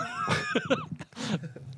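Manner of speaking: shrieking high laugh. Real, not acted. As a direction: burst into a squeaky laugh. After the superhero voice.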